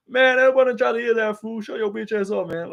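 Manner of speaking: high-pitched mock kid voice; Chandler's kids. Streamer copying a friend's Chandler's kids bit, high mock voice. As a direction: put on a high, cartoonish impression. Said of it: "he does the Chandler's kids impression".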